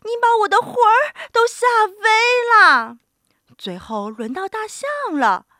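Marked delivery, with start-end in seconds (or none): 0.0-3.0 high, loud, rapid shriek; fast, panicked old woman; frightened cry. A narrator's reading. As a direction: shriek as if scared out of your wits. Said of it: "瘦小的老妇人喊起来".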